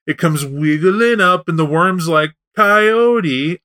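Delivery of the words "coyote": lightly voiced plaintive worm, higher and drawn out. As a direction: call out to Coyote in mild complaint. Worm whines to Coyote in story.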